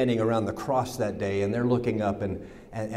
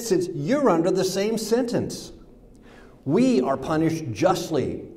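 rebuke; 6 semitones higher than usual. Acted. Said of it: "the other criminal rebuked him".

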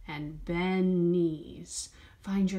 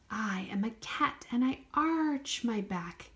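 whisper. Pretend. Cat line whispered like a cat.

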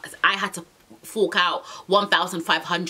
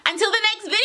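playful squealed sign-off; 13 semitones higher, much faster than usual. Pretend. Vlogger signing off to viewers, high playful squeal. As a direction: sing it out high to the fans. Sign-off, first of two takes.

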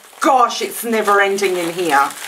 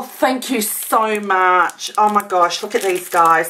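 usual speaking voice

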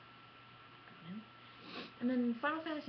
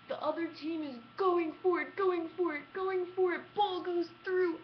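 building excitement; 8 semitones higher, 5 dB louder than usual. Retold or imitated. Retelling the last seconds of the match, rising higher and louder. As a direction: build the tension toward the goal.

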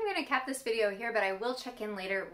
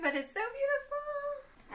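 excited; slower than usual. Real, not acted. Admiring fresh baklava.

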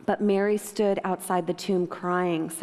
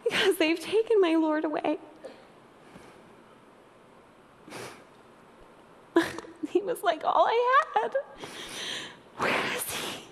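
high, choked, tearful pleading; heartbroken crying; 10 semitones higher than usual. Acted. Mary weeping to the angels about her missing Lord, heartbroken crying. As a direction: weep through the words, pitch climbing, with long broken pauses. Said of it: "her heart is broken, and she's crying".

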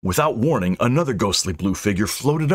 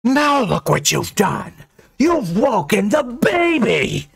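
high, slow shriek of fury; enraged high-pitched wail; ghostly rage; 13 semitones higher, 3 dB louder, slower than usual. A narrator's reading. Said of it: "Willow's rage grew".

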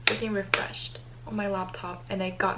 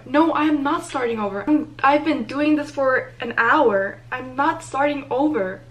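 indignant refusal; 4 semitones higher, 8 dB louder than usual. Real, not acted. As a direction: refuse outright, loud and exasperated. Refuses error message to start over.

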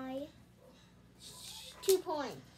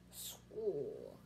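low monster voice; 5 dB quieter than usual. Acted. Monster smashing the school.

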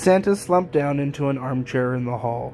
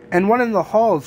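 high, louder storybook elf call; crying out; 6 semitones higher, 5 dB louder than usual. A narrator's reading. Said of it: "cried one of the elves".

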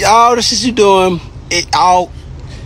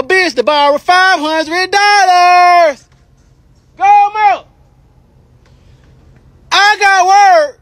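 sing-song gossip announcement; gossiping high voice; 7 semitones higher than usual. Real, not acted. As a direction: spill the tea in a high voice.

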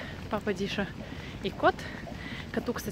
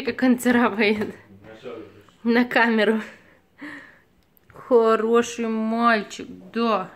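squeaky praising a dog; 4 dB louder, much slower than usual. Real, not acted. Praising the cat.

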